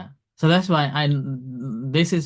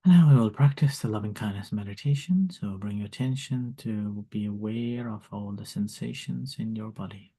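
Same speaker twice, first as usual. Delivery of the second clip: guided meditation; 3 semitones lower, 5 dB quieter than usual. Acted. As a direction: lead them gently into calm. Leads loving kindness meditation.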